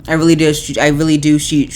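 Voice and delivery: ordinary talk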